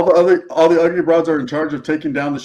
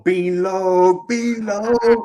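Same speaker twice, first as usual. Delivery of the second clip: drawn-out mock shout-out; 3 semitones higher, much slower than usual. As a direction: stretch the name out, rising on the repeat. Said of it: "I'll go".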